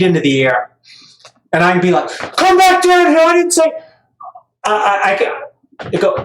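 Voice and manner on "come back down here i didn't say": put-on high, louder playful call to a kid tossed in the air; mock calling out. A dad playfully calls his tossed child back down, high and loud.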